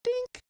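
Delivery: tiny high-pitched dink; imitated clink. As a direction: squeak the ice-cube clink. Imitating ice dropped from tongs.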